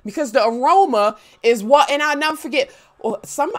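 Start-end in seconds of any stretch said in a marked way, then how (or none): none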